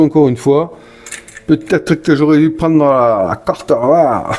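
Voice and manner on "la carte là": slow southern French drawl on the card name; southern French accent. Pretend. Player slipping into a southern French accent while naming the card. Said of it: "Ça, c'est l'accent du Sud".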